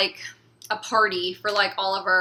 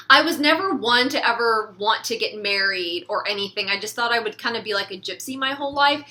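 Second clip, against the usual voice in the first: usual speaking voice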